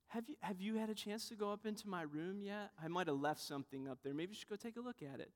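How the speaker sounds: soft, mock-casual fishing for praise; quietly offhand, as if it hardly matters; subtle hint. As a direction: imitate someone coyly dropping a hint. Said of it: "We try these subtle ways to justify ourselves".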